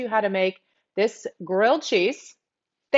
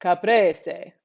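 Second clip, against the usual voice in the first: caprese in an Italian accent with tapped R; Italian pronunciation. Shown as a demonstration. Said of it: "how you say it in Italian".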